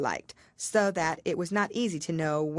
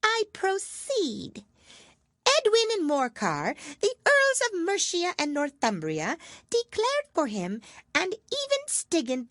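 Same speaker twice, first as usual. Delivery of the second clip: self-important mouse; 13 semitones higher than usual. A narrator's reading. Mouse recites dry history to dry everyone, pompous. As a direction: lecture like a tiny schoolmaster. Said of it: "mouse resumes lecture".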